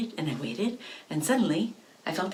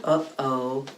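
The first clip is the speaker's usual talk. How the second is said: ordinary delivery, nothing marked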